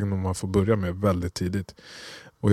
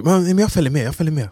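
put-on child voice; 8 semitones higher, 4 dB louder than usual. Son Kenji begging to come along.

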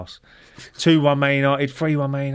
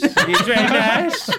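put-on high, loud, drawn-out foreign accent; Borat; 12 semitones higher, 8 dB louder, much slower than usual. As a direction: stretch out a mock-foreign catchphrase, high and loud. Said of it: "he talked like Borat".

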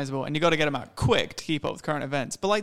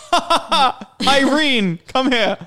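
higher mock laugh calling to his wife; hammed-up chortle, then call Irene over; chuckling cartoon husband; 7 semitones higher, 5 dB louder, slower than usual. Husband calling his wife Irene over, a higher hammy chuckle. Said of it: "Who's just like".

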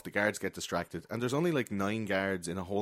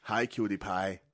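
quoted Damon Killian; slower than usual. Retold or imitated. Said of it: "Damon Killian says that to him".

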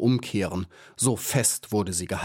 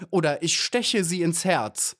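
high-pitched defiant cry, only slightly raised volume; mild screamed threat; 7 semitones higher than usual. A narrator's reading. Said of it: "schrie Maya". (gemäßigt geschriene Drohung)

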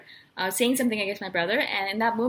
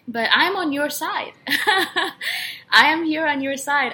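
slow, louder plea as the father; emphatic reassurance; 4 semitones higher, 5 dB louder, much slower than usual. Retold or imitated. Father to a protective child, emphatic reassurance. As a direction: stretch it out, insisting you are an ally.

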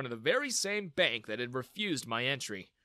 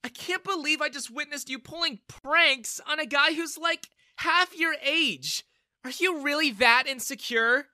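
high, louder disgusted reproach, slowing on half your age; scolding; 12 semitones higher, 7 dB louder than usual. A narrator's reading. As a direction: shame him for picking on a kid. Daughter scolding her father.

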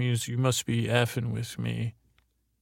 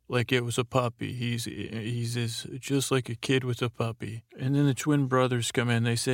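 normal talking voice

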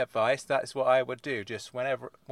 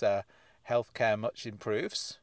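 normal talking voice

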